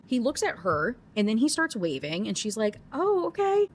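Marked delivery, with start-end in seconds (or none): 2.9-3.7 much higher, caught-off-guard oh okay; an embarrassed little realization; mock surprised oh. She realizes the wave isn't for her.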